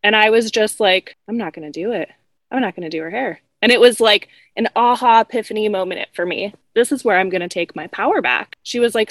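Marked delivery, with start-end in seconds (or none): 1.3-3.4 low, soft inner resolve; quiet refusal. Retold or imitated. Hairdresser privately deciding to refuse a difficult client, quiet resolve.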